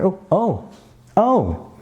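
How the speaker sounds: high, climbing surprised oh's; rising oh's. Shown as a demonstration. Demoing a reaction, rising surprised oh's.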